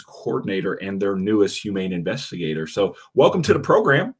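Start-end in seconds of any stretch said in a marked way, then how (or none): 3.1-4.1 excited. Real, not acted. Host welcoming guests.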